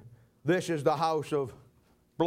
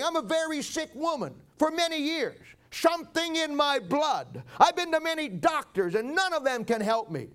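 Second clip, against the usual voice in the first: lightly raised, pleading voice of a weak sick woman; a hint of a desperate, ailing woman; 9 semitones higher than usual. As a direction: beg the tax collector as a frail widow.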